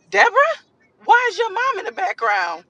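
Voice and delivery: high, loud disbelieving call; mock outraged shout; exasperated yell. Exasperated at mom listening in.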